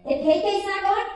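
high-pitched mock protest; imitated defiant mom. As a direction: pitch it way up, refusing to budge. Tipsy mom refuses, retold.

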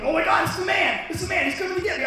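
high, frantic cry of terror; panicked yell. Retold or imitated. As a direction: yell as if someone is coming for you. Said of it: "I yelled at him".

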